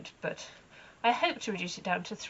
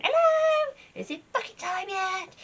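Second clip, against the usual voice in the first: very high, loud calling horse voice; horse shouting; 13 semitones higher, 8 dB louder than usual. Pretend. The owner voicing her gelding calling from two fields away, high shout. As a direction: call it sky-high across the fields like an eager horse. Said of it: "he's shouting at me from two fields away".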